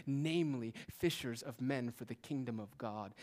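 usual speaking voice

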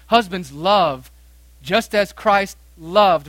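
slow, loud reading with love leaned on; slow emphatic scripture read. Acted. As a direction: dwell on each word of the verse. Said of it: "Verse twenty-five".

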